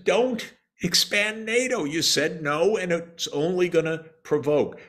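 high-pitched retold warning; urgent warning. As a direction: imitate an insistent warning, pitched well up. Quotes ambassador's warning against expansion.

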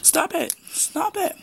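high, pleading cries of stop it; upset pleading. Real, not acted. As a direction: beg them to stop, near tears. Kind fleht, Streit eskaliert.

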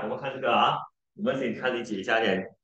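usual speaking voice

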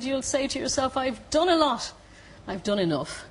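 high mock Cork lilt, falling at the end; terrible Cork accent. Mountaineer predicting the climber will give up, mock Cork accent. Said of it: "it was a terrible Cork accent".

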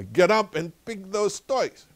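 high, loud, drawn-out command; scolding order. Retold or imitated. Grandpa orders tantrum-throwing granddaughter to tidy up, scolding. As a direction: scold slowly, pitched well up.

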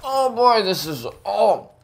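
high strained groan. Real, not acted. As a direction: squeak through the sourness. Very sour candy reaction.

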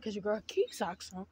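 usual speaking voice

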